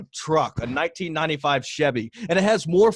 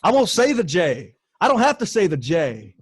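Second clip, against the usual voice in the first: trash-talk call out; 3 semitones higher than usual. Acted. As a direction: hype it up like a boxing promo, taunting. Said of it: "do the official call out now".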